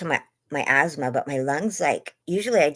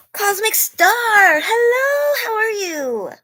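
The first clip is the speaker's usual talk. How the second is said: much higher, louder squealed hello; gasping excited shout; 7 dB louder, slower than usual. Real, not acted. Streamer thrilled as a favourite viewer joins. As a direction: gasp and shout with joy at a friend.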